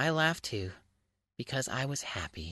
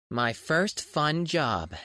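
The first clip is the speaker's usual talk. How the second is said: title read slowly; 3 semitones higher, 4 dB louder, much slower than usual. A narrator's reading.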